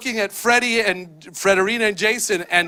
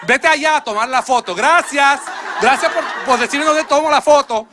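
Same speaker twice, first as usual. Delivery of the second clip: cheerful thanks; 4 semitones higher, 3 dB louder than usual. Retold or imitated. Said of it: "Not once did I have to speak in English".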